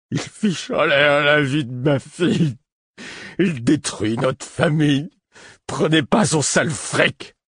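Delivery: high, loud, slow wailing lament; tearful outburst. A narrator's reading. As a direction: break down sobbing at the guards. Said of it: "il éclata en larmes". (lamentation en larmes, aiguë et lente)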